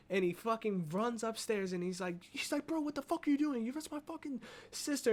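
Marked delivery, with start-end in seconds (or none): 2.5-5.1 imitated outraged brother, voice pitched way up; high-pitched retold outrage, protective and incredulous; angry confrontation. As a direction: burst in indignant, high and heated. Acting brother confronting attacker.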